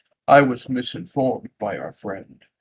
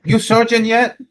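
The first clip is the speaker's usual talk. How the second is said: nagging parent; 9 semitones higher, 4 dB louder than usual. Retold or imitated. Mock immigrant parent nagging.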